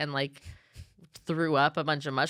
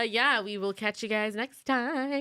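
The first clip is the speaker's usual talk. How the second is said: podcast sign-off; 6 semitones higher, slower than usual. Acted. Sign-off to listeners.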